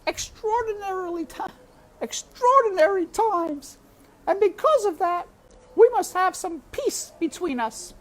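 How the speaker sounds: high, loud cook making a deal; cook bargaining. A narrator's reading. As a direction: strike a bargain with the mouse. Cook makes pact with mouse.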